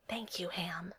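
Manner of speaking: very soft, near-silent whisper; mouthed. A narrator's reading. Steve secretly thanks the piggy bank, mouthed in a whisper. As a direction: whisper it, barely voiced. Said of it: "Steve mouthed".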